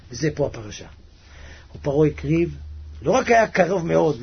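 ordinary talk

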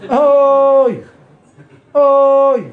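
high, loud, held cry of oy; loud wailed oy. Acted. Someone crying out while mopping the floor, long loud oy. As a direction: let out a long full-voiced wail. Demonstrates crying out amid housework.

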